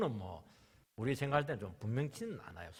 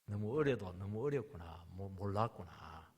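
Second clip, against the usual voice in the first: rueful realization; 8 semitones lower, slower than usual. Retold or imitated. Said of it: "뒤집어진 거죠".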